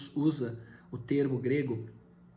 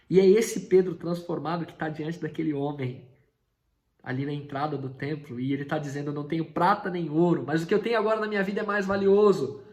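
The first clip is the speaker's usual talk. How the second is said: loud, raised preaching voice; 3 semitones higher, 6 dB louder than usual. Acted. Preacher retells Pedro's words to the beggar, loud. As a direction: drive the point home to the congregation.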